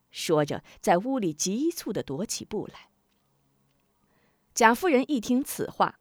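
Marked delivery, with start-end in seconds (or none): none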